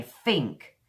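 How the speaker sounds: "think" as "fink", teeth on the lip; Cockney f for th. Shown as a demonstration. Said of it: "They put their teeth on here".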